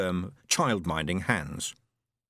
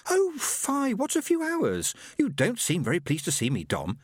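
reproachful; 9 semitones higher than usual. Acted. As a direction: chide him in a high girlish voice. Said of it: "she added reproachfully".

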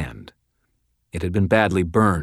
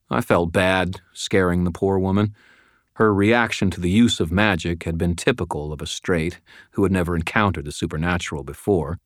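ordinary delivery, nothing marked

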